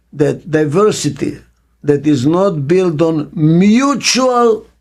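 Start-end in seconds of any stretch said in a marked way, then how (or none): 3.3-4.7 "mutual" drawn out slowly and raised in pitch; stress on mutual. Real, not acted. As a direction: lean hard on "mutual", stretching it out. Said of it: "I'm emphasizing the word mutual".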